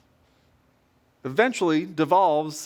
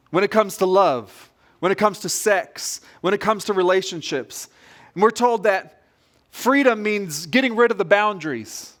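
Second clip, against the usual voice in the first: ordinary talk, nothing marked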